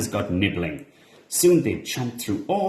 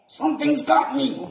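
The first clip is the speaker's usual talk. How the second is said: high frightened yelp. A narrator's reading. Trapped iguana being pulled back, high frightened yelp. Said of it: "he yelped".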